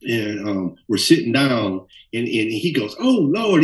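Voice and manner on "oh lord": high, dramatic "Oh, Lord"; flamboyant dismay. Retold or imitated. Hairdresser Alice inspecting the messy hair, high theatrical dismay. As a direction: fuss over a hopeless hairdo. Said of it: "very flamboyant".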